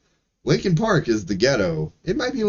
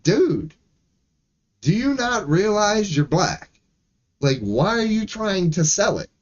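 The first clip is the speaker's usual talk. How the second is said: higher, exasperated plea; a mock exasperated talking-to, much higher; exasperated retort; 8 semitones higher than usual. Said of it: "That's when I'm like".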